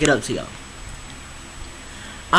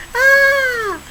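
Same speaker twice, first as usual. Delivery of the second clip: sudden yelp. Real, not acted. Sudden yelp mid-intro.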